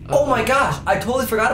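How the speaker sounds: excited. Real, not acted. Suddenly remembers castle section.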